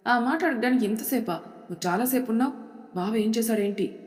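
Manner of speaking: ordinary delivery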